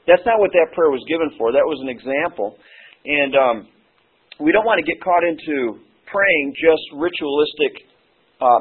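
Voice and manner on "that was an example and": ordinary delivery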